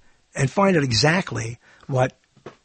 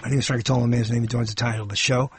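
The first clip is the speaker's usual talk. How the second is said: ordinary talk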